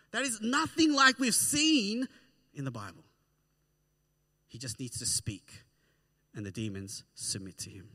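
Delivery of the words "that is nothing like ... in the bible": emphatic climax. Real, not acted. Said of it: "preacher builds to a climax".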